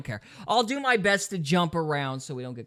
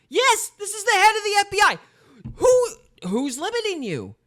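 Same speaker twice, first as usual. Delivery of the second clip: very high, loud ranting; exasperated shout; 7 dB louder than usual. Real, not acted. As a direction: yell the challenge in disbelief. Host rants at the FBI head.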